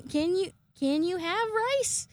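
high, drawn-out question; slow it down and pitch it high; mock exasperation. Owner asking the pestering cat, high and exasperated.

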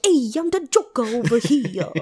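a hint of a cartoon Joker, cheesy; bad Joker impression. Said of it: "my really bad Joker impression".

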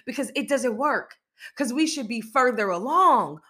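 normal talking voice

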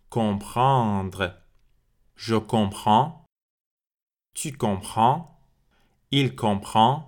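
slow, clear model conjugations with pauses; repeat-after-me drill. Shown as a demonstration. Teacher modelling conjugations for Persian learners to repeat, slow and clear. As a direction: say each form for the class to echo. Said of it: "Répétez après moi".

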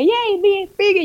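high, light imitation of a cheering crowd; a pretend hooray from schoolkids; mock cheering. Classmates cheering after she won the fight, mock cheer. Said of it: "todo el mundo como que".

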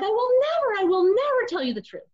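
high, loud mock husband shouting back; husband refusing. Husband's refusal voiced in story.

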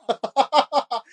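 sudden high giggle burst; high burst of laughter. Real, not acted. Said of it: "我忍不住了啊".

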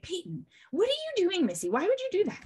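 high, quick, mock-scolding mom voice; a mum asking her dog what it's doing, high and fast. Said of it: "her mom will talk to her and be like".

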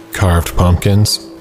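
ordinary delivery

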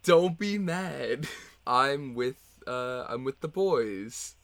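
slow guilty pleading; sheepish excuse. Acted. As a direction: drag it out guiltily. Guilty excuse to angry partner.

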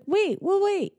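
high, startled "wait, well, wait"; panicked Emmett. Retold or imitated. Emmett panicking as he slips, high and startled. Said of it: "And he's like".

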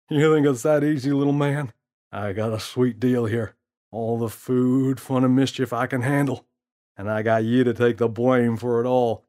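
put-on smooth-talking prankster teasing the kid; gorilla's voice. Gorilla mocks James.

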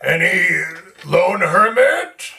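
loud, slow call into a cave; shouting out. Acted. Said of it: "I'm gonna shout out".

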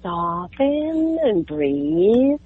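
slow, drawn-out softening; soften and breathe. Shown as a demonstration. Mom reminding herself not to sound sharp, slow soothing delivery. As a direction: stretch the words out as if calming yourself. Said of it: "what I'm always trying to".

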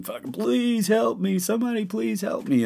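high desperate pleading cry; retelling an injured man screaming for help; pained scream. Retold or imitated. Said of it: "He's laying on the ground screaming".

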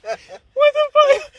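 high, loud, very slow, cracking wail; laughing disbelief. Retold or imitated. Reacting to her fake emergency call: high, slow, laughing wail. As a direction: drag it out, laughing and crying.

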